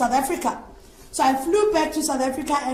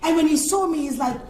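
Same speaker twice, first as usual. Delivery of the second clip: ordinary delivery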